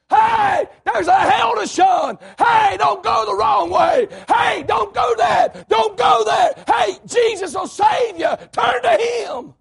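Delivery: high, drawn-out hollered warning; yelling preacher. Shown as a demonstration. Preacher showing how to warn sinners of hell, yelling. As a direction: holler it out slowly at full force. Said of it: "raise his voice".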